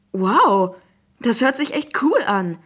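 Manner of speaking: put-on thrilled boy, loud and swooping; excited. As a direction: gush with a big wow. Boy thrilled by new game. (begeistert staunend)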